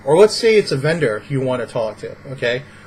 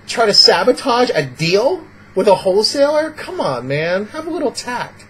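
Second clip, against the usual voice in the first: incredulous, pitched-up reproach; mock scolding; 6 semitones higher, 3 dB louder than usual. Vlogger scolds someone sabotaging a wholesale deal, incredulous. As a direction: chide them in mild disbelief. Scolding an imagined rude customer.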